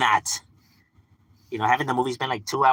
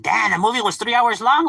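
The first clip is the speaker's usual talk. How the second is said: higher, louder griping moviegoer; mock complaint; 11 semitones higher, 5 dB louder than usual. Acting out a moviegoer griping about runtime, mock complaint. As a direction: imitate an incredulous complainer.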